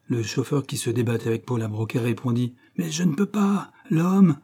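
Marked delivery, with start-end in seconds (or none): 2.8-4.4 strained protest. Acted. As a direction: cry out that he can't. Stoker protests while struggling. (le chauffeur proteste en se débattant, plus haut)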